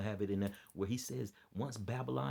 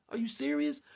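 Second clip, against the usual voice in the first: high, loud shocked question; stunned disbelief; 7 dB louder than usual. Real, not acted. Reacting to the news, loud high disbelief. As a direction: let the disbelief burst out.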